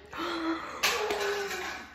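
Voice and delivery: sudden high excited gasp; a high, breathy gasp of delight; sharp gasp. Real, not acted. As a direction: gasp as if overcome. Overwhelmed by the finished bread.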